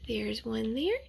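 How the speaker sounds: ordinary delivery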